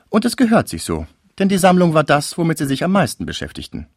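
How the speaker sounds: deutlich höhere Mädchenstimme; hoch ansetzen, wie ein artiges Fräulein; junge Frau. A narrator's reading. Cécile spricht, hohe Figurenstimme. (hohe Stimme einer jungen Frau)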